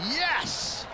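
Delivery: high excited cheer; excited yes. Real, not acted. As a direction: celebrate the ace. Cheering the hole-in-one.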